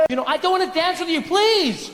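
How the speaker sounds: high-pitched, pleading refusal; woman refusing advances. Acted. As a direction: beg the creep to back off. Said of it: "you're in a compromising position".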